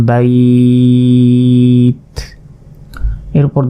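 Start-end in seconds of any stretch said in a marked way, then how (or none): 0.1-2.0 বাইত sustained, with a bare t at the end; stretched pausal form. Shown as a demonstration. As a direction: draw বাইত out on one steady note, closing on t. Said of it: "সাকিন হিসাবে এই তাকে".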